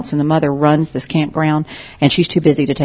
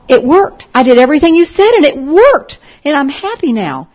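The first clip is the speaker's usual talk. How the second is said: excited; 11 semitones higher, 8 dB louder than usual. Retold or imitated. Joyful girl reports success.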